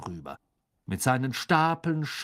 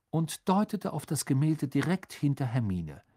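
plain reading voice

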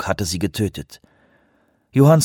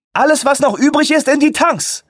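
shouting orders; 4 dB louder than usual. Acted.

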